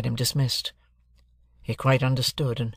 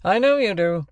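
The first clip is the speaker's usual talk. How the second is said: loud, higher, cutting reply; cold retort; 7 semitones higher, 5 dB louder than usual. Acted. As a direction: cut him down coldly.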